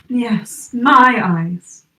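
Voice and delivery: ordinary delivery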